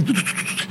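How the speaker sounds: rapid high "tu-tu-tu"; quick stuttered sound effect for getting it done; imitated procedure noise. Imitates quick treatment being given. (imitando un proceso rápido, "tu, tu, tu")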